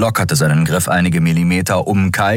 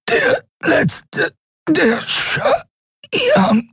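hohe, gequälte Würgestimme; würg die Worte einzeln hervor; ersticktes Röcheln; much slower than usual. A narrator's reading. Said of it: "seine Stimme wurde zu einem unverständlichen Röcheln". (röchelnd herausgepresst)

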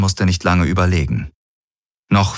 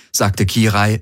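ordinary delivery, nothing marked

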